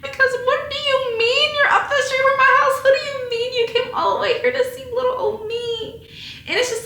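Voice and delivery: high, loud tearful crying words; real crying. Real, not acted. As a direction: let the tears break through, real. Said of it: "I'm just so grateful".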